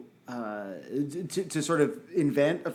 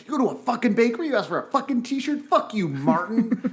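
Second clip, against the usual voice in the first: hammed-up angry singer telling off a kid; mock furious rant; 7 semitones higher, 4 dB louder than usual. Musician mock-scolding the teen interviewer, hammed-up fury. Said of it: "him being like".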